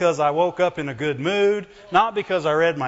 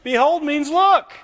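high, loud correction; emphatic correction; 10 semitones higher, 6 dB louder than usual. Real, not acted. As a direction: declare it firmly. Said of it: "correcting meaning emphatically".

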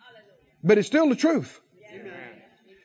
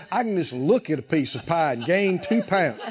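usual speaking voice

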